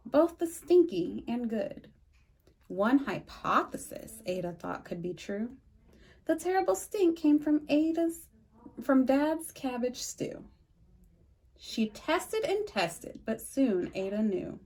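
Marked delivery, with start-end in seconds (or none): none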